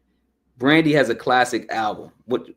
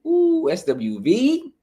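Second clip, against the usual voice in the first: long, much higher, slow swooping ooh; excited ooh; 13 semitones higher, slower than usual. Real, not acted. Reviewer greeting the group SWV with a slow high ooh. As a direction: savor the name with delight.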